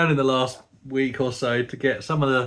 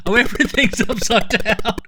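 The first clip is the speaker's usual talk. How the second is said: excited surprise; 9 semitones higher, 4 dB louder than usual. Real, not acted. Game screen flips upside down.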